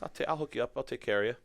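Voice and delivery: lightly voiced eager buddy; friend's reassurance. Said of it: "He's like".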